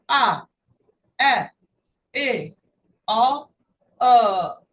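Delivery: punched single short vowels; loud phonics drill of short vowels. Shown as a demonstration. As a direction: call out each short vowel firmly. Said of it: "vogais ... de sons curtos".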